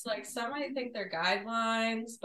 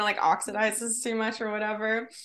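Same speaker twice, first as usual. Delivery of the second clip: ordinary delivery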